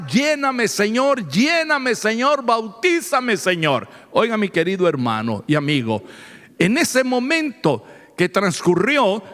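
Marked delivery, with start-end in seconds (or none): none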